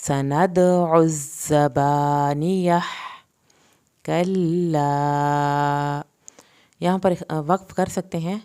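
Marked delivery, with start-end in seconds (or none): 0.1-2.7 slow intoned verse; slow recitation. Shown as a demonstration. Teacher to students: slow tajweed chant. 4.0-6.0 "کلا" extremely slow, long a; stretched vowel. Shown as a demonstration. Teacher to students: extremely slow, long a. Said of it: "اس کو کھینچ کے پڑھنا ہے".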